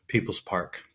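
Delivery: ordinary talk, nothing marked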